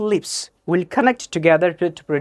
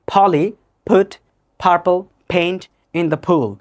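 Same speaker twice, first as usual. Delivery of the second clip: very slow, word by word with pauses; slow tongue twister; much slower than usual. Shown as a demonstration. Teacher starts the tongue twister for learners, slowly. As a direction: separate each word and pop every p. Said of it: "প্রথমে slowly".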